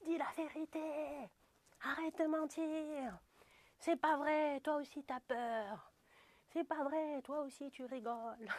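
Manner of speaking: high, slow, eerie voice of spirit guides; spirit guides' voice. Retold or imitated. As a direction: play the guides scolding from beyond. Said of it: "Mes guides, ils m'ont dit".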